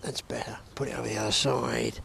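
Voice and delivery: soft whisper to himself. Real, not acted. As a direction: murmur it without voice. Whispered after recast.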